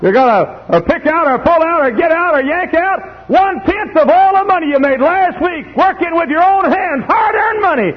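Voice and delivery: high, loud preacher's tirade; full-force preaching. Real, not acted.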